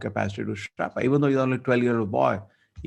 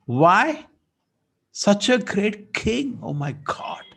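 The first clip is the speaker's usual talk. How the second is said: much higher, slow, aghast; a sage aghast at his son's curse; dismayed; 10 semitones higher, 3 dB louder, much slower than usual. Acted. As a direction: recoil in horror.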